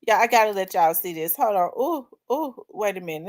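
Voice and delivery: ordinary talk, nothing marked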